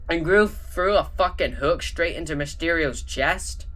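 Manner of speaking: ordinary delivery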